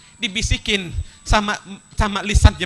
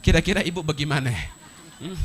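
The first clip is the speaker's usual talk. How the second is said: normal talking voice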